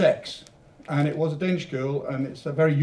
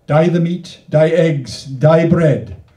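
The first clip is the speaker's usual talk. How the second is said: poem reading; 3 semitones higher, 9 dB louder, much slower than usual. Acted. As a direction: perform the canto with bouncing rhyme.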